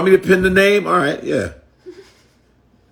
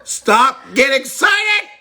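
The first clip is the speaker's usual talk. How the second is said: "Stop." then burst into a very high "Get excited!"; halt, then squeal the excitement; mock excited shout; 8 semitones higher, slower than usual. Mocking the commenter's phrase, hammed-up excited shout.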